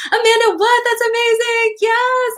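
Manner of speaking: high, delighted exclamations; thrilled squeal. Real, not acted. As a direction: burst out in delight at the comment. Reading chat, thrilled.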